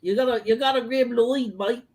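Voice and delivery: jokey imitation New Zealand accent; Braden from New Zealand. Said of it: "accent you use for Braden from New Zealand".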